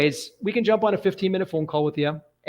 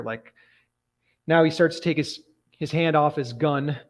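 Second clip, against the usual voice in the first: normal talking voice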